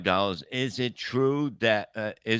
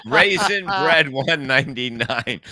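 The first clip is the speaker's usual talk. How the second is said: loud reading through laughter; laughing; 7 semitones higher, 8 dB louder than usual. Real, not acted. Host reading a donor's name, laughing hard. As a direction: read it out while cracking up.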